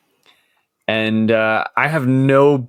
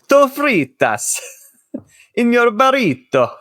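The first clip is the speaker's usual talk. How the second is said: put-on Italian accent; 11 semitones higher than usual. Podcaster joking about a burrito filling, Italian accent. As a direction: sing it out like a mock Italian. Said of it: "saying that with an Italian accent".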